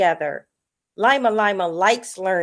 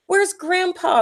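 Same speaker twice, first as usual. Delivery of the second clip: high, alarmed question; suddenly notice he's gone; high anxious call; worried surprise; 9 semitones higher than usual. A narrator's reading. Missing his swim buddy.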